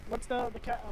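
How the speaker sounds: usual speaking voice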